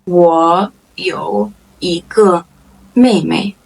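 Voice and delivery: very slow, word by word; slow model sentence. Shown as a demonstration. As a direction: pause after each word for learners. Same sentence repeated slowly.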